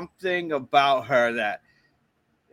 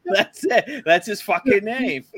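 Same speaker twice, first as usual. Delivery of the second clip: laughing; 7 semitones higher, 4 dB louder, slower than usual. Real, not acted. Laughing at the silly name.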